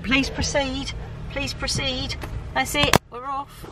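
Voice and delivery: usual speaking voice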